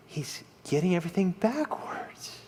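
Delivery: hushed, breathy whisper. Real, not acted. Preacher whispering to the congregation about Saul. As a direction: drop to a whisper to make the point.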